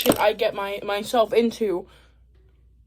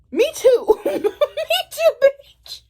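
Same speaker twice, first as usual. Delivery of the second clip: giggling high exclamation; laughing; 14 semitones higher, much slower than usual. Real, not acted. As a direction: crack up while saying it. Laughing at own escape.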